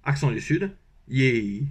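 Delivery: ordinary delivery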